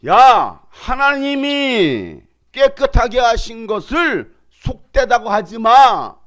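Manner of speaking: furious divine scolding; angry God. Acted. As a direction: thunder the scolding slowly. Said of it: "하나님이 열받았어요".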